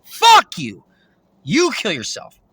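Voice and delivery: sudden loud shout of fuck you; angry yell. Retold or imitated. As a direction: snap back at full volume. Angry retort in argument.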